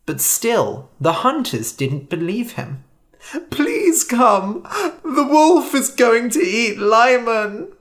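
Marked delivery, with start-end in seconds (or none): 3.5-7.7 pleading. A narrator's reading. George begs the hunters.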